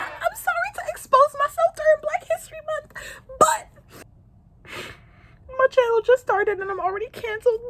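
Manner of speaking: high, wailing fake sob through the apology; mock crying apology. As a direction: beg forgiveness in a hammed-up weepy voice.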